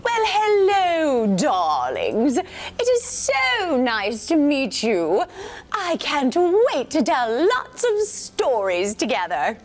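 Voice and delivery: high, slow, grand English lady voice; drawn-out, sweet British matron; posh English lady. Acted. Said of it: "So I think she sounds like this".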